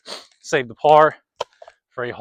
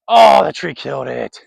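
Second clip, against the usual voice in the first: dismayed; 10 dB louder than usual. Real, not acted. Disc hits tree.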